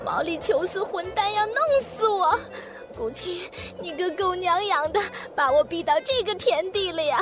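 high, wailing tantrum; crying scream. A narrator's reading. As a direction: scream it out in tears. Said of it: "何木子哇地哭了，喊". (哭喊)

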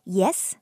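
Yes? rising like answering your name being called; teacher showing the second tone with a rising Yes?. Shown as a demonstration. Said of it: "like responding to when someone is calling your name".

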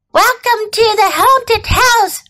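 high-pitched theatrical call; storybook skeleton crying out; skeleton's cry. A narrator's reading. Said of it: "cried a skeleton".

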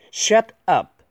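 emphatic, separated model reading; slow word-by-word. Shown as a demonstration. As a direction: split "Shut" and "up", pitched high.

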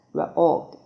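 ordinary delivery

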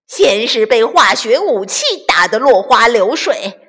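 loud high raging bandit; furious villain. Acted. Bandit chief ranting loudly at losing the battle. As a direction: rant at the defeat.

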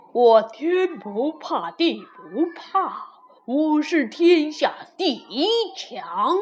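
proud, deliberate voice of a mighty wall; chest-out boasting wall. A narrator's reading. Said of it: "挺着胸回答道".

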